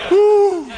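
high whooping holler, a touch of laughter; excited whoop. Real, not acted. Preacher whoops to the church in joy. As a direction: let out a joyful preacher's whoop.

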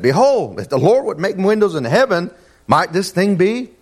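high-pitched scripture quote with a touch of sarcasm; sarcastic. Acted.

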